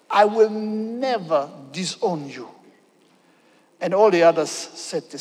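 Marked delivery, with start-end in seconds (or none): 0.1-2.5 slow defiant vow. Acted. Peter's vow voiced.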